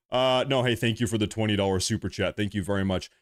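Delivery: ordinary delivery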